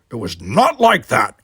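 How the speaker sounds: slow, loud snarl; snarled through gritted teeth. A narrator's reading. Worf denies the accusation, snarling. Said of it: "Worf snarled through gritted teeth".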